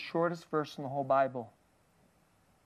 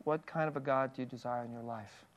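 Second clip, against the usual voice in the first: softer, with "kind" leaned on; quieter repeat; 4 semitones lower, 8 dB quieter than usual. Shown as a demonstration. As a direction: ask it again gently and searchingly. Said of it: "This is the question".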